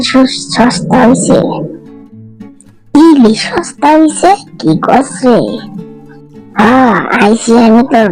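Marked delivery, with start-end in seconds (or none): 3.0-5.4 high, drawn-out disbelief; stretched, high-pitched surprise; astonished question. Pretend. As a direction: echo the news in hammed-up amazement. Reacts to news of cheap fish.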